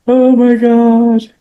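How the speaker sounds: sharp gasp in, then a loud, slow, high "Oh my God"; crying gasp. Shown as a demonstration. Demonstrating a hard cry to listeners, gasping and loud. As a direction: demo a sobbing person's sudden intake of breath. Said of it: "It's like, cry, cry, cry".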